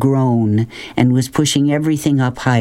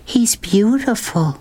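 awed; 9 semitones higher, slower than usual. A narrator's reading. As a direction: breathe it out in wonder. Girl in awe of tiger.

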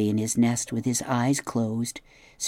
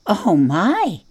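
high startled gasp, louder; a frightened little animal catching its breath; 6 semitones higher, 5 dB louder than usual. A narrator's reading. Opossum gasping in fright at the robot. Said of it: "the opossum gasped".

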